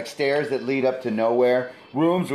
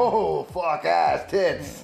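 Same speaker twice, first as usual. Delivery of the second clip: raised, drawn-out startled outburst; mock startled cursing; 6 semitones higher, much slower than usual. Acting out a reaction to a scare, mock startled cursing. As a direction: blurt it out slowly in mock shock.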